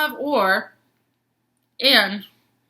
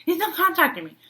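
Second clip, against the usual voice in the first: whiny frustrated; 11 semitones higher, faster than usual. Retold or imitated. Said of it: "but I was like".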